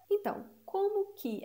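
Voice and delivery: ordinary delivery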